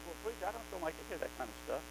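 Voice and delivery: a hint of a reluctant churchgoer, said quietly; grumbling listener.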